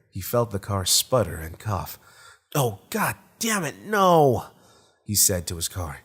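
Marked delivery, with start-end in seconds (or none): 2.5-4.4 high, frustrated outburst that peaks loud on "no"; exasperated cursing. Acted. Tracy curses his sputtering car in high, loud exasperation. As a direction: curse with rising dismay, ending in a loud cry.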